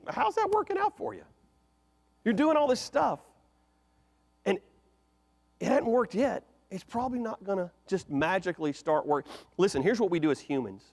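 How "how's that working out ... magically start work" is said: much higher, teasing sarcasm; mocking question. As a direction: needle them about their wasted effort. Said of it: "our modern interpretation could be".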